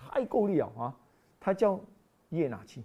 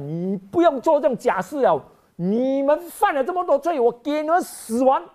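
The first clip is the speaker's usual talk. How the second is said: mock angry God; 9 semitones higher, 7 dB louder than usual.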